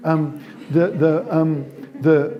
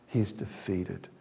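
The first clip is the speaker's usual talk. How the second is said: lower, softer and slower, sinking; deflated; 6 semitones lower, 9 dB quieter, slower than usual. Shown as a demonstration. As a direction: let the air go out of him.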